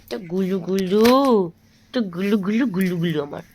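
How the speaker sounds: gentle cooing to her little one. Real, not acted. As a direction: coo it like soothing an infant. Parent cooing to baby.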